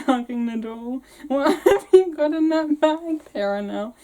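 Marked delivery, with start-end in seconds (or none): 1.3-3.9 laughing through line. Real, not acted. Fanny voiced, laughing.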